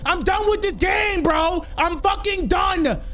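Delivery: high furious ranting; raging gamer. Real, not acted. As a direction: rage quit it, shouting. Raging at the game.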